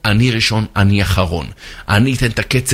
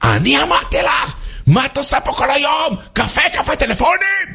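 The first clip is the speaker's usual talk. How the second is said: high, strained shouted tirade; hammed-up outraged yelling; angry manager. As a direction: explode at the secretary in a high voice. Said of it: "מנהל מכירות היה עצבני".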